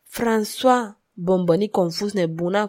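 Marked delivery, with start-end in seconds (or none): none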